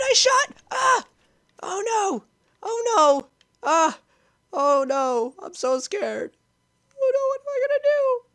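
scared gamer wailing in panic; high panic. Real, not acted. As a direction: freak out, losing control. Panic after blunder.

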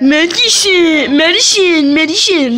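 medicine said high and silly, mimicking the other; a lightly voiced mock of his sister's way; mocking imitation. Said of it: "This is how you sound".

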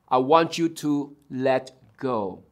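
low, soft, measured instruction; acted God, quietly firm; calm God. God tells man on branch to let go, calm low voice. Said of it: "and God said".

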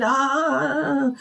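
imitated scream. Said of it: "she was screaming".

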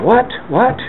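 startled what. Retold or imitated. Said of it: "And I'm going".

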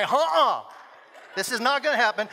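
loud, hammed-up refusal; indignant mama bear. Mama bear confronting the other woman, mock outrage. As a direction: imitate a mother ready for a fight.

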